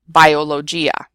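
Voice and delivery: "biologia" said bio-lo-JEE-a, English j; mispronounced G. Shown as a demonstration. Teacher showing what nobody will understand, "biologia" with English j. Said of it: "ignore things like the G".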